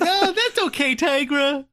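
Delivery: very high, put-on forgiving voice; broken-down villain. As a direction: squeak out a mock-meek reassurance. Broken Poltergeist answering Tigra, voiced.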